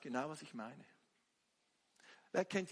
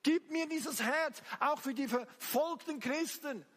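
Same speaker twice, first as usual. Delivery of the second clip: loud, high, pleading prayer cry; impassioned preaching; 7 semitones higher, 6 dB louder than usual. Real, not acted. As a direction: cry out to God at full force. Preaches and prays at full force. (leidenschaftlich flehend gepredigt)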